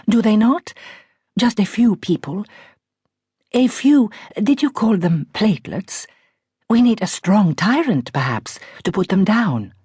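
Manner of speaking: light posh Iranian lilt, coolly ironic; posh Iranian accent. A narrator's reading. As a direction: lecture the doctor like a lofty Persian aristocrat. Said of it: "tremendously posh voice with its just perceptible Iranian R".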